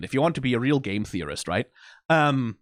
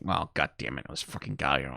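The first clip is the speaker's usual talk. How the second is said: much lower, much softer gruff grumble; grumbling Husk; 12 semitones lower, 9 dB quieter than usual. Retold or imitated. Reactor voicing Husk's disgust at Alastor's return, low and grumbling. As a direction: mutter it like a fed-up old bartender. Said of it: "Husk is like".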